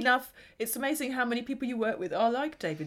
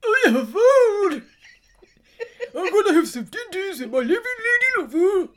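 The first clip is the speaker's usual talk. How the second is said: mock Shiv impression; 7 semitones higher, 8 dB louder than usual. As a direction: stretch it out, higher and louder, teasing her. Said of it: "Here's my Shiv".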